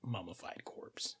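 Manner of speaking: soft eerie narration; hushed. A narrator's reading. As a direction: let it drop to a hush. GM describes corpse.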